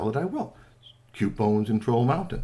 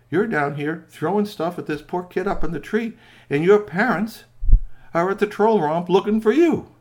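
stern scolding; 8 semitones higher, 8 dB louder than usual. Acted. Strongbones scolds Grassy.

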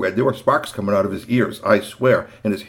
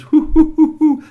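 hooting; 9 dB louder than usual. Acted. Boys teasing bears.

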